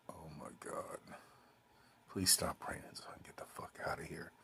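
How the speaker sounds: hushed desperate whisper; breathy whispered begging under the breath; whispered plea. Retold or imitated. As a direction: whisper it like a silent prayer. Inner plea under the poncho.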